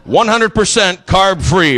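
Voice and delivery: slow, punched slogan; slogan read. Shown as a demonstration. Comic announcing his new tour t-shirt, slow proud slogan. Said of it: "which simply states".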